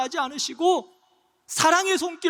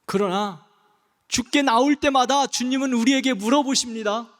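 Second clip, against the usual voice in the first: ordinary talk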